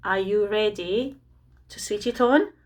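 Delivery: ordinary talk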